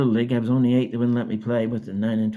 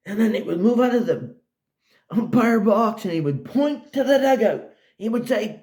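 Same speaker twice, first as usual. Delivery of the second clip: excited retelling; 11 semitones higher, 5 dB louder than usual. Retold or imitated. Describing umpire, raised voice.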